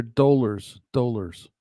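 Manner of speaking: "dollars" drawn out with a long o, like "dolers"; Canadian-style "dollars", first vowel rounded to oh; Canadian pronunciation. Shown as a demonstration. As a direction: slowly demonstrate "dollars" as "DOH-lers". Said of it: "tell me the pronunciation".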